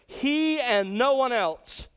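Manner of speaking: "He" hit high and slow; high, slow stress on the word "He"; emphatic he. Shown as a demonstration. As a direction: land hard on "He" and no one else. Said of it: "the pronoun he is emphatic".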